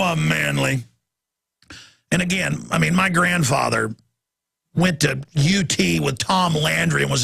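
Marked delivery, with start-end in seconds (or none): none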